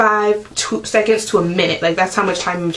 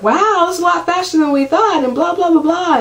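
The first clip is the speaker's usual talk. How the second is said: much higher, chirpy mock doctor; 9 semitones higher than usual. Doctor remarking on the fast labour, mocked in a high chirpy voice. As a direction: parody the doctor's breezy surprise. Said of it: "my doctor comes in and she's like".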